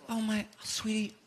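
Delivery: soothing mom. Retold or imitated. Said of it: "She goes".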